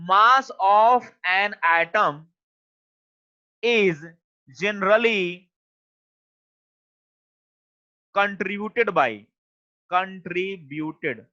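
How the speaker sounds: slow dictation. Real, not acted. Teacher writing out a definition for the class, slow dictation. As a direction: dictate at writing speed, piece by piece.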